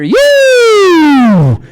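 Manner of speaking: loud slow mocking siren voice; booming siren chant; siren taunt. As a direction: blare it out like a siren. Mocking new siren sound.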